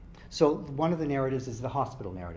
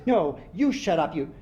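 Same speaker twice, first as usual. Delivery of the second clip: angry yell back; 5 semitones higher, 8 dB louder than usual. Acted. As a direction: throw it back in her face. Dylan yelling back.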